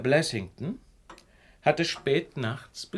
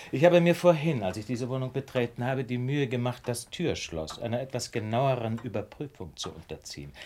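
ordinary talk

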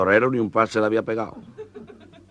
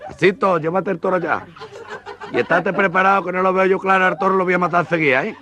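frantic boast; 8 semitones higher than usual. Acted. Matador boasting nervously.

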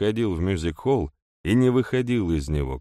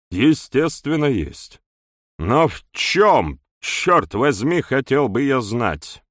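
raised, slow exasperated demand; 7 semitones higher, 6 dB louder, slower than usual. Acted. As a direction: push the question out, exasperated. (раздражённо, с нажимом)

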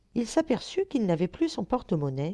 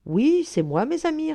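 happy insistence; 10 semitones higher, 4 dB louder than usual. A narrator's reading. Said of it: "répéta l'heureux élu".

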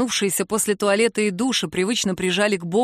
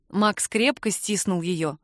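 usual speaking voice

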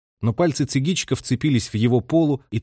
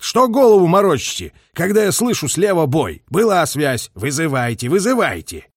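loud, higher-pitched scolding; commander's irritated outburst; angry rebuke; 5 semitones higher, 4 dB louder than usual. A narrator's reading. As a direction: berate the operator, demand action. Colonel berates the radio operator.

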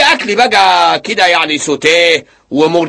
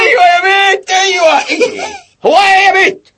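annoyed shout; 11 semitones higher than usual. Acted. Husband snapping back at wife.

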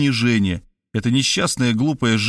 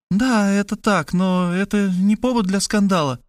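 a higher, lighter woman's voice; Kristina's voice; 9 semitones higher than usual. Acted. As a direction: play the sister, pitched up and urgent.

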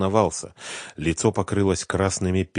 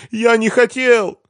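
high, loud tearful wail; desperate high-pitched crying plea; 9 dB louder, slower than usual. A narrator's reading. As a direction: wail it as if in tears. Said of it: "плакал Семён".

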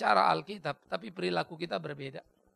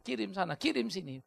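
ordinary talk

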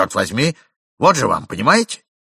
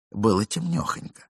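plain reading voice

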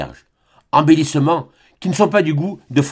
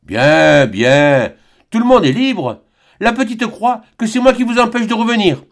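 jovial peasant hail; 9 semitones higher than usual. A narrator's reading. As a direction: call across the road to the nervous priest. Jean Bernat parle au curé. (l'oncle interpelle le curé, fort et goguenard)

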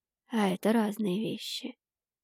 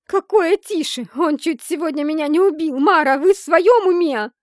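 much higher, loud outraged cry; outraged yell; 7 semitones higher, 11 dB louder than usual. A narrator's reading. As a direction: shout in disbelief at Mara. Heroine outraged, yelling. (возмущённый крик)

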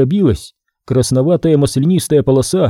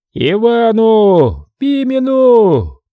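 crowd shouts; 9 semitones higher, 4 dB louder, much slower than usual. A narrator's reading. Crowd shouting rival names.

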